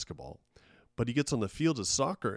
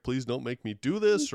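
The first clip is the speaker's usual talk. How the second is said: put-on louder, a light pleading child voice; mock kid pleading; 4 semitones higher, 6 dB louder than usual. Kid begging parent not to make him play, mock pleading. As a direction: beg like a reluctant kid, lightly. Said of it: "the kid's like".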